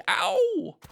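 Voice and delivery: very high, loud pained yelp. Acted. Piglet bumping down in Kanga's pocket, high pained yelp. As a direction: squeal as you land hard. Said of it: "he said".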